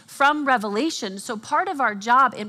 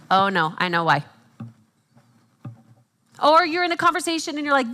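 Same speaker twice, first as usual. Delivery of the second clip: usual speaking voice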